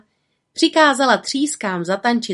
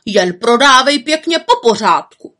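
loud, raised insistence; shouting; 4 semitones higher, 8 dB louder than usual. A narrator's reading. People in front urge the girl to keep order, shouting. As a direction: call out firmly from the front. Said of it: "křičeli lidé vpředu u holčičky".

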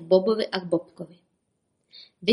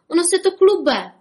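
high, loud panicked cry; frightened shriek; 10 semitones higher, 6 dB louder than usual. A narrator's reading. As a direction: shriek it in alarm. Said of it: "zaječel Bobek". (vyděšené ječení)

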